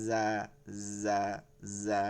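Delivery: "জা" with a long buzzed z; soft buzz. Shown as a demonstration. Teacher drilling the buzzing letter, soft. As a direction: buzz gently into "জা". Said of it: "জির জিরের মধ্যে নরম".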